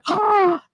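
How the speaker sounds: high shocked gasp; sharp, high gasp. Real, not acted. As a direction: gasp at the line. Reaction to joke.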